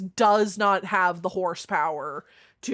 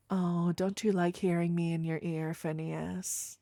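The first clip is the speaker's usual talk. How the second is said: sultry soft voice; 4 semitones lower, 8 dB quieter than usual. Pretend. Teasing viewer about voice in ear.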